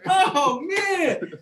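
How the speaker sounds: ordinary talk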